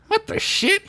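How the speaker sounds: high squeaky startled voice; a bewildered little adventurer; high character voice. Acted. Bilbert's startled thought.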